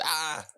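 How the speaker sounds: "ah" high and open, no R; Bostonian R. Pretend. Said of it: "pronounce it like a Bostonian".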